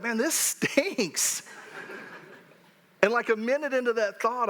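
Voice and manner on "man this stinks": slow, drawn-out grumble; mild disappointment. Retold or imitated. Fan grumbles slowly after his team loses. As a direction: let the letdown sag out slowly. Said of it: "a part of me that's like".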